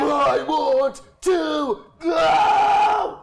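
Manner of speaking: very slow, loud, high death-metal shout; promposal. Acted. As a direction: bellow it like a metal prom invite. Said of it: "Death Metal Promposal voice for one page".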